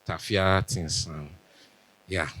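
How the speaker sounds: normal talking voice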